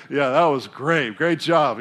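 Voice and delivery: slow, loud, dry sarcastic praise; mock sarcasm. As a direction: drag it out like fake praise. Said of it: "sarcastically be like".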